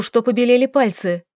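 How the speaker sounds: ordinary delivery, nothing marked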